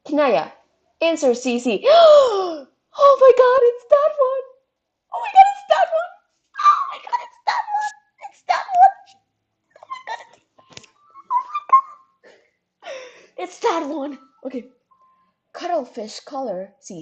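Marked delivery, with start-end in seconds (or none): 1.9-2.6 excited gasp. Acted. Fan spots her favourite. 3.0-12.9 very high, loud squealing excitement; fangirl squeal. Acted. Fangirl squealing over a find. 13.4-14.2 near-tearful excitement. Acted. Fangirl squealing over a find.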